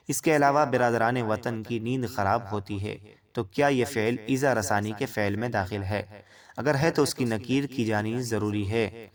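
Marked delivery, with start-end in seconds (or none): none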